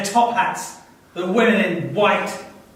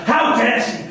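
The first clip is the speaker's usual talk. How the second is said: indignant; 3 semitones higher than usual. Acted. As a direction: spit out the outrage. Soldier outraged by woman.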